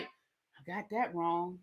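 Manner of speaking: soft, slow sheepish mumble; a quiet embarrassed retreat; mumbled admission. Retold or imitated. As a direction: own up under your breath. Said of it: "Tucker was like".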